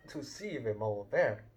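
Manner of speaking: ordinary delivery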